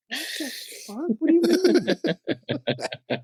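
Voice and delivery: high, incredulous squeal with a laugh; half-laughing shriek of disbelief; shocked disbelief. Retold or imitated. Shocked reply to friend's admission.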